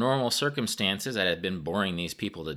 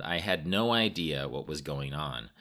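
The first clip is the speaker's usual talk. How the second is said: ordinary delivery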